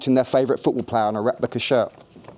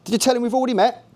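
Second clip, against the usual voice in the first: Cockney accent with a hint of West Country; lightly voiced Cockney man, a touch West Country; 11 semitones higher, faster than usual. A narrator's reading. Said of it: "Jake spoke in a Cockney voice".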